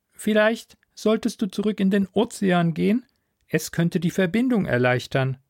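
high-pitched mentor voice, measured suggestion; suggesting Philomen mentor. Acted. Mentor advising a return to the ocean, high-pitched suggestion.

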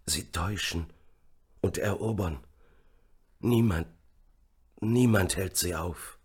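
soft dying words with long pauses; feeble stammer. A narrator's reading. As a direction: struggle out each phrase weakly. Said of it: "stammelte er". (kraftlos und stockend gestammelt)

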